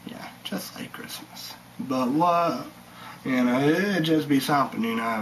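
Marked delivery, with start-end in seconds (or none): 0.1-1.5 soft whispered aside. Real, not acted. Vlogger whispering an aside to viewers, unvoiced. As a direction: breathe it under your breath.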